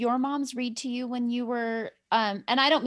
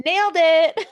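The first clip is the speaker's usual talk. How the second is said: laughing; 9 semitones higher, 9 dB louder than usual. Real, not acted. Teasing praise while laughing.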